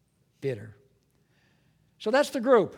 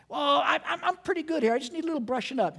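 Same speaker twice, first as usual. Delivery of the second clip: higher, smug little voice mocking the self-righteous; mock self-satisfied protest; 9 semitones higher, faster than usual. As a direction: play the proud sinner who needs only brushing up.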